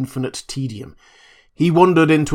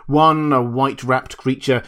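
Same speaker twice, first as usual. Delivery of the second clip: plain reading voice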